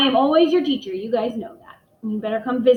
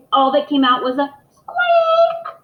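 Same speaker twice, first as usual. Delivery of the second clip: squeaky narration; 5 semitones higher, 6 dB louder, slower than usual. A narrator's reading.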